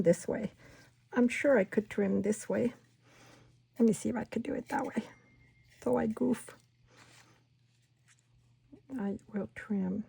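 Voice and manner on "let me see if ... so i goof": ordinary talk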